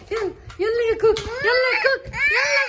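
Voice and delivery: excited urging. Real, not acted. Mother urging her toddler to walk to her, excited.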